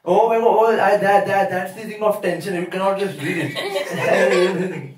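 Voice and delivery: ordinary talk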